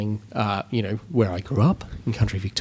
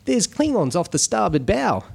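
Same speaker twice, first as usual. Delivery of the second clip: lightly hammed Australian accent; a jokey Aussie starship officer; mock Australian accent; 6 semitones higher than usual. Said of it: "that's the worst Australian accent ever".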